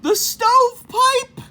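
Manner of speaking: high loud alarmed cry; gasped alarm. Acted. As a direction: javelina spots coyote in the stovepipe, high alarmed cry. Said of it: "gasped the third little javelina".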